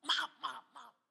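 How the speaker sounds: hammed-up startled apology, high and loud; panicked apology. Pastor apologises to the raging elder, loud high panic. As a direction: blurt it out in mock panic.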